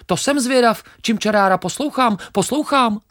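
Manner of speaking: very high, loud, quick chirpy sparrow voice; cheeky sparrow. Acted. Sparrow laughs off the grain, very high and cocky.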